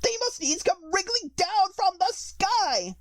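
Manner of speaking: very high pigeon shriek. A narrator's reading. Pigeon shrieks at Alice, very high. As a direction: rise to a frantic shriek. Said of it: "raising its voice to a shriek".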